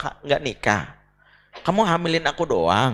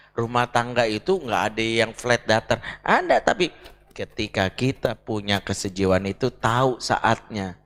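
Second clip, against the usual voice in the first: usual speaking voice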